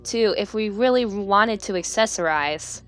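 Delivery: ordinary talk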